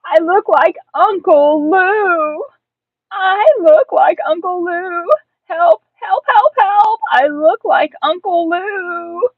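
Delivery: high, loud, drawn-out wail; hammed-up desperate shouting; panicked shouting. A narrator's reading. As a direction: holler for help like a frantic child. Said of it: "I kept running and shouting".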